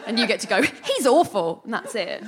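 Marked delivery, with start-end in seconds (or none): 0.6-1.6 slow, higher, drawn-out mock disapproval; sassy best friend. Sassy best friend about the main guy, mock-disapproving. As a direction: stretch it out with comic sass. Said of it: "you are the sassy best friend".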